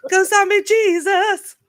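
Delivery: high, loud, drawn-out exclamation; excited shout. Real, not acted. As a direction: belt it out with glee.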